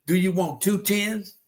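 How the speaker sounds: drawn-out, deliberate dad riddle; slow teasing riddle. Retold or imitated. Father teasing son asking for twenty, slow sly riddle. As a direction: spell it out slowly like a sly father. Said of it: "He said".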